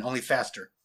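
plain reading voice

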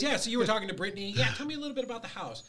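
upbeat pretend cold-call voice; mock sales call. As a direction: play the friendly caller pitching up to the seller. Role-plays the follow-up sales call.

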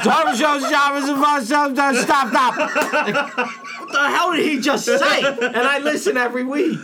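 high, laughing protest, stop stop; laughing disbelief. Real, not acted. Laughing disbelief at host's rant.

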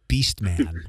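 deep cartoon announcer. Naming villain in deep voice.

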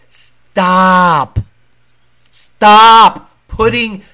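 loud, drawn-out STOP; emphatic plea. Real, not acted. Urging a YouTuber to protect his family, loud and emphatic. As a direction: boom out each stop, stretched long.

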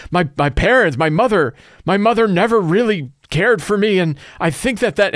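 a hint of a weepy tough guy; emotional bandit. Bandit pours out life story.

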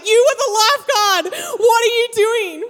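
high-pitched mock outraged cry; exasperated scolding. Stranded friend scolding the self-proclaimed lifeguard, exasperated. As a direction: imitate a friend berating someone useless.

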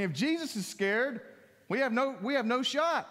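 ordinary talk

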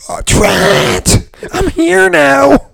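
high, slow whiny mock voice; mocking wife. Bartender mocking his wife waiting at the bar, high slow whine. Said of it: "Now I got Janelle going".

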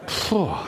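sharp breath in, then a blown-out phew; relieved gasp. Acted. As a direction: act out huge relief. Acted sigh of relief.

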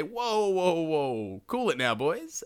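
drawn-out whoas, starting high; calming intervention. Acted. Advisers jump in to cool the boys, peacemaking. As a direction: jump in to break up a quarrel, easing down.

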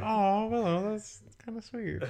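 a friend imitating Cordelia going soft; mock sweet Cordelia. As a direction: coo it like a touched teen girl. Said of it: "She's like".

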